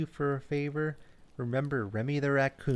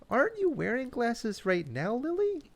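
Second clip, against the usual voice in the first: much higher, puzzled question; confused; 11 semitones higher than usual. Acted. Remy puzzled at the owl wearing glasses, much higher. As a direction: ask it baffled, pitch climbing. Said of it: "Remy looked confused".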